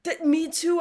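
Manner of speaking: normal talking voice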